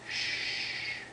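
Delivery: soft shh; quiet shushing sound. Acted. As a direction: hush everyone. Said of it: "Gorilla says".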